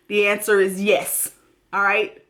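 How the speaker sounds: ordinary talk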